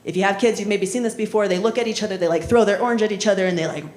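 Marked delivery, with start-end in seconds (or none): none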